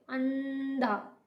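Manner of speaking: अंधा said very slowly with a nasal न; slow nasal demo. Shown as a demonstration. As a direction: stretch अंधा, letting न hum. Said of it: "उसे न के रूप में बोलेंगे". (अंधा को धीरे, न की ध्वनि के साथ)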